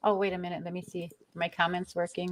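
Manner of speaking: ordinary talk, nothing marked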